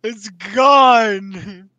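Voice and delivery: very high, loud, drawn-out wail; dismayed scream. Real, not acted. Player finding the green juice gone, screaming in dismay. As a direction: scream it out in dismay, stretching every word.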